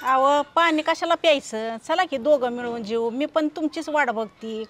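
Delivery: low, soft, affectionate reassurance; tender coaxing. Acted. Wife invites tired husband to eat together, tender coaxing. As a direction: soothe him gently, voice dropped low.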